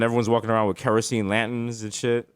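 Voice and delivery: ordinary delivery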